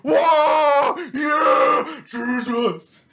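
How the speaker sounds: high, loud whooping imitation of a church crowd; hyped crowd shout. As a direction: mimic a congregation getting hyped, shouting high.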